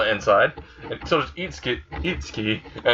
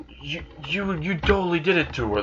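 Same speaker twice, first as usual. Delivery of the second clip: flustered king; 5 semitones higher than usual. Retold or imitated. Cornered king stammers an accusation.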